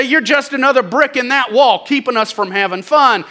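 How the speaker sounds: high, loud mock accusation; imitated worldly complaint. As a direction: complain like a scornful onlooker. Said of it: "The world will look at us and say".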